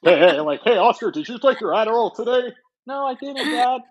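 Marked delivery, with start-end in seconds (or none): 0.7-2.5 dad voice. Pretend. Voicing dad in skit. 2.9-3.8 put-on high, whiny kid voice; high child voice. Voicing child answering dad.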